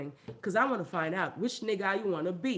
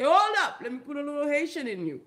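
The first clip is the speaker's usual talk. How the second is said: loud stern call; 7 semitones higher, 5 dB louder than usual. Retold or imitated. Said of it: "I gotta sit them down and say".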